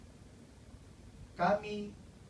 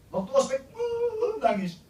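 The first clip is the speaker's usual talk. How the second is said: high, hammed-up sob through the words; mock crying; 8 dB louder, slower than usual. Preacher mocking freshmen who wept at orientation, hammed-up sob. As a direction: play-act a weepy freshman, overdone.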